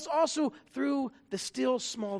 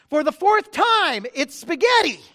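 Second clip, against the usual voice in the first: resolute; 6 semitones higher, 10 dB louder than usual. Retold or imitated. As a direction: snap back, fed up, spelling it out. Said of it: "resolutely replied".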